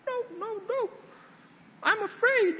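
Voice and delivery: put-on a timid kid backing away from the water; scared little boy. Little Mehmet refusing his dad, high and scared.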